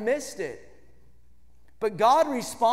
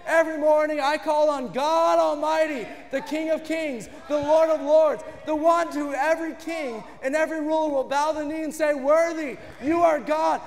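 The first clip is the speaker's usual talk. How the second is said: full-force preaching; 6 semitones higher than usual. Acted. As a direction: lift the room, proclaiming God above all.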